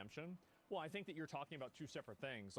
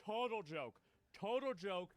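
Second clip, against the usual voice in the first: louder, higher, "Total" hit hard in contempt; disgusted scoff; 4 semitones higher, 4 dB louder than usual. Real, not acted. Streamer scorning the speaker's Christianity, loud disgusted scoff.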